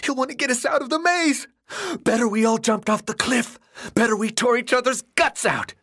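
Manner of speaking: high, panicked pleading; frantic. Acted. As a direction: sound desperate and unhinged. Ben raving about Thomas.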